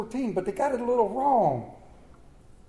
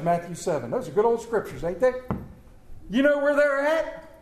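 ordinary delivery

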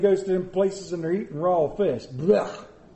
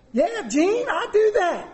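much higher, laughing mock sarcasm; mocking family; 12 semitones higher than usual. Preacher mocks his family's sarcastic reply, high and laughing. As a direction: poke fun at the family's eye-rolling reply.

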